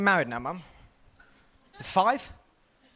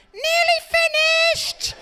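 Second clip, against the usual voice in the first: high loud sing-song mum; mum calling out; 7 dB louder than usual. Retold or imitated. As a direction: call it like mum at bath time. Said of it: "your mum's going".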